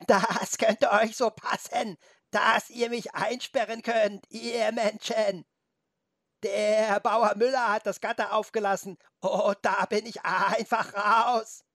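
high, slow, drawn-out bleating goat voice. A narrator's reading. Goat mocks the humans who penned it in, bleating. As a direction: stretch and wobble the vowels like a goat. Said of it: "und meckerte".

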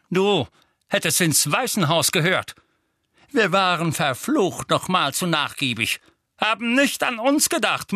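hoch und laut, schimpfender Vernon; brüll den Jungen wütend an; wütender Onkel Vernon. Acted. Onkel Vernon tobt Harry an. (Onkel Vernon wirft Harry schimpfend raus)

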